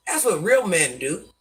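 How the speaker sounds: normal talking voice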